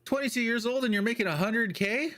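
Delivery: high, rising incredulous question; mock disbelief. Retelling how viewers reacted, high mock disbelief. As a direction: voice the crowd's shock. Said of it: "they're like".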